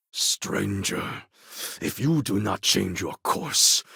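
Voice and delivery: ordinary talk, nothing marked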